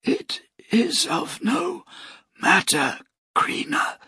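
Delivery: high then fading to a breath. A narrator's reading. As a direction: let the voice die away. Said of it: "his voice fading".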